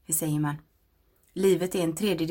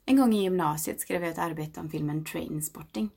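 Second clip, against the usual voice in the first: usual speaking voice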